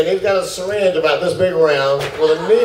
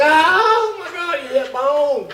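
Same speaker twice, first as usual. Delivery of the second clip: high-pitched, drawn-out mock panic; retold screaming; 11 semitones higher, slower than usual. Patient thinks the needle hit bone, hammed-up screaming. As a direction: wail it high, acting out the agony. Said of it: "And I was screaming".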